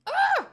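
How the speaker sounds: very high, loud ah; shrill outburst of annoyance; frustrated scream. Real, not acted. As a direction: let out a short scream.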